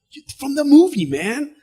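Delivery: high, eager fan voice; excited fan. Retold or imitated. Teller pointing out the actor, high and eager. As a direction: point him out with glee. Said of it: "super excited".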